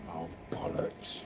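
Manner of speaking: whispered curse. Real, not acted. Muttered curse to himself.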